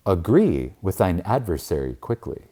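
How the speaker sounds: normal talking voice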